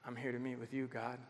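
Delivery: soft, low, intimate prayer voice; a hushed, sincere prayer; quiet prayer. Shown as a demonstration. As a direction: speak it like a humble whisper to God. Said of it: "this is what I want you to say".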